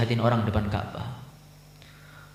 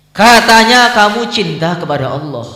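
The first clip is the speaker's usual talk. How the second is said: high, loud, reproachful call from above; rebuking voice; 9 semitones higher, 11 dB louder than usual. Acted. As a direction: thunder the challenge down at him. A voice without form rebukes Ibrahim.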